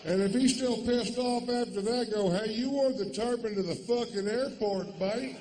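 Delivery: high, loud rant. Acted. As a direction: work yourself into an outraged frenzy. Rant continues, mock confronting.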